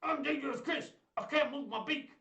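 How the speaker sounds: high-pitched bird puppet; squeezed-up character voice; Dangerous Chris. Pretend. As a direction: speak up high as a toy with a stuck beak. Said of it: "stay there on Dangerous Chris's beak".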